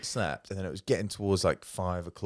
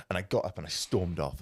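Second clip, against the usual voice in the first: usual speaking voice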